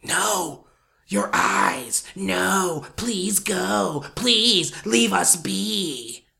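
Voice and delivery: eerie stage whisper of a forest; whispered plea, drawn out at the end. Retold or imitated. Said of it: "it whispered".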